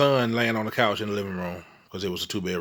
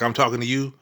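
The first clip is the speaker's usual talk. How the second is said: ordinary talk, nothing marked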